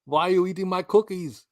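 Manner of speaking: amateur action-hero impression; lightly voiced, clumsy Arnie; bad Arnie. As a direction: have a go at an Arnie impression, badly. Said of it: "I'm so terrible at it".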